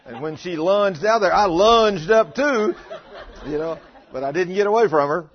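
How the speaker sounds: real laughter spilling into the story; laughing while telling. Real, not acted.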